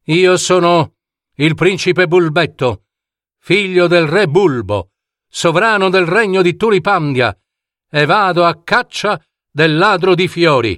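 high-pitched, declamatory prince; proud young prince. Acted. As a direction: announce yourself as a bold royal challenger. (principe fiero che si presenta con voce acuta)

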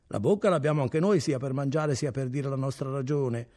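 ordinary delivery, nothing marked

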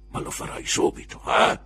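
sussurro forte e duro; bisbiglio minaccioso. A narrator's reading. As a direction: minaccia sottovoce. (Creakle avverte il ragazzo in un bisbiglio)

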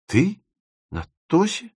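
high, rising disbelief; astonished questioning. A narrator's reading. Timofeev hears of Dima's wedding, astonished. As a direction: echo it as two stunned questions.

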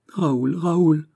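high, anguished call to her lover; pleading cry. A narrator's reading. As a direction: call his name, begging him to stop. Heroine begging him to stop. (appel suppliant, voix aiguë)

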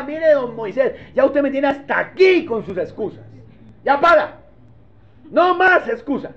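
God losing patience with Moses; fed-up God. Acted. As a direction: patience gone, cut him off. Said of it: "enojado ya".

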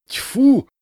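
loud, high burst of disgust; furious spit. A narrator's reading. Shopkeeper spitting at being singled out, furious. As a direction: spit it out explosively. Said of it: "яростно плюнул лавочник".

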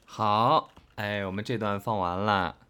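usual speaking voice

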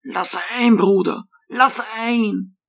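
put-on old hermit begging at the gate, softly; pleading kindly. A narrator's reading. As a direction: plead gently to be let in. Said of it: "begann zu flehen und freundlich zu bitten".

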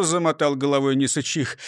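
ordinary delivery, nothing marked

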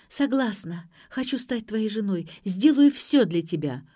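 plain reading voice

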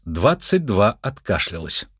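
ordinary delivery, nothing marked

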